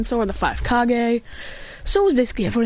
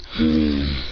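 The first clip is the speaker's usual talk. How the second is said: a low, rumbling thoughtful hum; deep hmm. Retold or imitated. Boy voicing a character thinking it over, low hum. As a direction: drop way down for a pondering hmm. Character pondering in retold scene.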